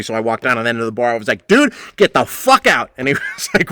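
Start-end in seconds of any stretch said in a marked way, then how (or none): 1.5-2.9 angry order. Retold or imitated. Throwing out drunk customer.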